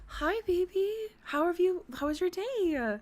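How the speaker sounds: put-on high, gushing girlfriend voice; hammed-up sweet girlfriend; girlfriend roleplay. Roleplays mango girlfriend greeting partner.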